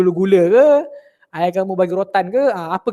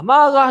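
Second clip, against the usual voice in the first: marah said higher and louder, falling; stressed scold word; 5 semitones higher, 4 dB louder than usual. Retold or imitated. Teacher listing a mother's scoldings to pupils, punching marah high and loud.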